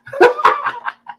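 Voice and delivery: short high-pitched laugh; amused high cackle. Real, not acted. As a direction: burst into high, quick giggles. Laughs at own complaint.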